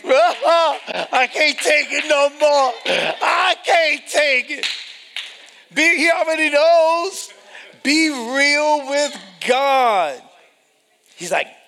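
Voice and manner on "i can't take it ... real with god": high, loud, slow anguished plea; desperate cry. Acted. Preacher voices a broken believer, loud high plea. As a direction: cry it out slowly at full voice.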